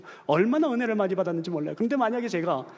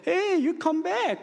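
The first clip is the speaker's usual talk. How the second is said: excited greeting; 10 semitones higher, much slower than usual. Retold or imitated. Parents greeting returning father.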